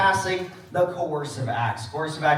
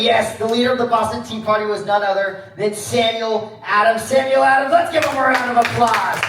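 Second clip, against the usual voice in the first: loud announcer build, rising higher; show host introduction; 4 semitones higher, 7 dB louder than usual. Pretend. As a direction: introduce the star to a cheering crowd.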